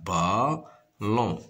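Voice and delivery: "ballon" in two separated beats; stretched "ballon" with a gap; syllable by syllable. Shown as a demonstration. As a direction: sound out "ballon" as ba, pause, lon. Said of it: "se compose de deux syllabes".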